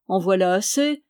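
plain reading voice

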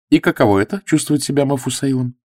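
mocking an older suitor; teasing. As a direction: poke fun at him. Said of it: "поддразнивание было более явным".

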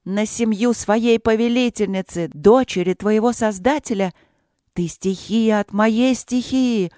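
the queen rages at the cowed spirit; furious yelling. Acted. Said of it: "в бешенстве орала королева".

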